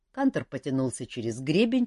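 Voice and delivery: usual speaking voice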